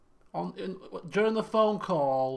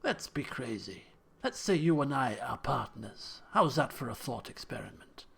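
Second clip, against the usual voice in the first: reading game dialogue; 5 semitones lower than usual. Acted. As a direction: float a hypothetical idea, measured and low. Reads hypothetical game dialogue.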